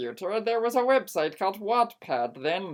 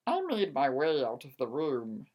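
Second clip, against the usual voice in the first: ordinary delivery, nothing marked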